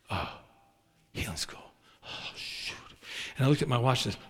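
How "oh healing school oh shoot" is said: much lower and softer, whispered in alarm; whispered dismay. Retold or imitated. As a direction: hush it like a sudden realization. Suddenly remembers healing school.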